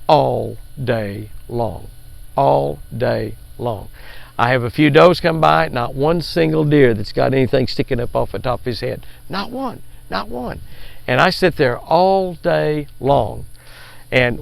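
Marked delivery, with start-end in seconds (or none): 0.1-3.9 very slow, each word stretched; drawn-out emphasis. Real, not acted. Stresses the endless wait. 9.3-10.6 high, softened little repeat; emphatic disbelief. Real, not acted. Stresses seeing no bucks. 11.8-13.5 slow, stretched-out words; drawn-out emphasis. Real, not acted. Stresses the endless wait again.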